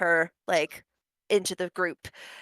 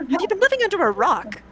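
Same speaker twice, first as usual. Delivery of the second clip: higher, faster disbelieving repeat; incredulous; 7 semitones higher, faster than usual. Acted. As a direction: fire it back higher and quicker in disbelief. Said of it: "She looks incredulous and horrified".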